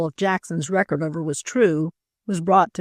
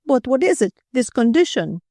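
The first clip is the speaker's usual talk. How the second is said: raised, urgent French husband; agitated cry; 6 semitones higher, 4 dB louder than usual. A narrator's reading. Husband demanding to know the condition, agitated. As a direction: cry out the question, pitch lifted. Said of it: "he cried".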